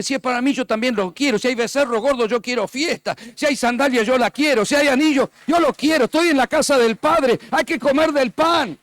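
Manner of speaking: building to a loud, high preaching shout; proclamación creciente. Retold or imitated. (prédica que sube hasta el grito)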